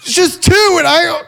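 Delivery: very high, mock panicked voice; freaking out. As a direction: whine it like a panicking friend. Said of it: "I'm just freaking out".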